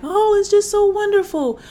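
high, loud mock rapture; grandiose gushing. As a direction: gush as if blowing it way out of proportion. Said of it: "I would make them grandiose".

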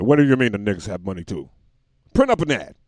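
lightly voiced boss, surprised then loud; quick disbelief building to a shouted order; advertiser. Advertiser learning of new customers, a hint of eager boss.

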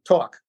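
"talk" with a rounded "aw" vowel; old New York accent on "talk". Retold or imitated. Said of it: "I may have talked like that".

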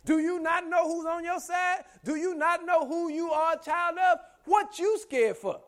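high, loud rhetorical challenge; full-force preaching. Acted. Preacher rebukes someone scared of trouble, full-force preaching. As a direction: drive each question home, pitched high.

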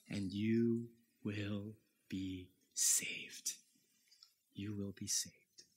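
slow, soft whisper of assurance; whispered promise. Acted. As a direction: whisper it like a secret comfort. Preacher's whispered promise of salvation.

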